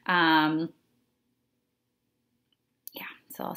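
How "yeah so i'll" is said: quick whisper, a bit faster; whispered aside. Real, not acted. Teacher whispering a quick note while moving on. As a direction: rush it under your breath.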